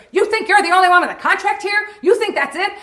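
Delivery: high, loud heated challenge; angry retort. Retold or imitated. Judd yelling back at Tony Clifton, high loud challenge. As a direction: pick a fight. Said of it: "He goes".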